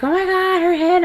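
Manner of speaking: high-pitched mock exclamation of wonder; imitated amazed onlookers. Onlookers' reaction at birth retold.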